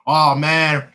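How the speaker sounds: ordinary delivery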